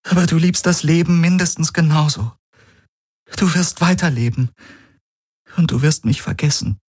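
hohe Frauenstimme, traurig und resigniert. Acted. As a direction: sag es hoch und schwer, voller Abschied. Ki voiced by narrator. (Ki zu Galen: hohe, traurige Stimme)

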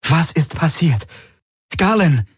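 high, urgent demand; demanding and worried. A narrator's reading. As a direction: press for an answer, very worried. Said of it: "Ihre Stimme war fordernd und besorgt".

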